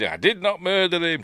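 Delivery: high-pitched cross outburst, imitated; mock-angry protest, pitched well up; retold anger. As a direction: act out an indignant denial. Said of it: "And then he gets cross".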